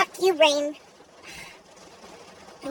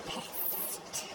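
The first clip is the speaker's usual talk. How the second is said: sighing exasperation; 22 dB quieter than usual. Real, not acted. Fed up with the rain.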